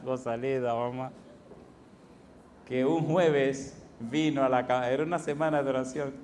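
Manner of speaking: usual speaking voice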